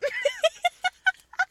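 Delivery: high, loud laughing fit; real laughter breaking out; burst of laughter. Real, not acted. Laughing at her own story. (lautes Loslachen)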